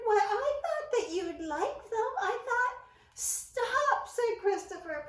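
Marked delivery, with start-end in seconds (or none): 0.0-2.8 higher, louder bewildered mouse; confused high little mouse; puzzled. A narrator's reading. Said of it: "Ced questioned and wondered". 3.1-4.1 higher, louder stop; high urgent mouse cry. A narrator's reading. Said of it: "putting up his hand".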